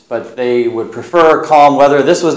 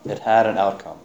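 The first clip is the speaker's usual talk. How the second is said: softer repeat, "had" lightly stressed; quiet echo; 4 semitones lower, 7 dB quieter than usual. Shown as a demonstration. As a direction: say "had" again, drawn back and softer.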